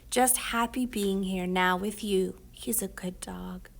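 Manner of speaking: whispered tenderly, slow at the end; soft whisper. Acted. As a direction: hush it like a lullaby to a sick child. Mom comforting sick son.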